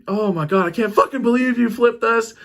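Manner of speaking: high-pitched mock outrage; retold freak-out. Brother furious that the canoe flipped, imitated angry freak-out. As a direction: imitate someone freaking out in disbelief. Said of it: "freaking out on you and cussing you out".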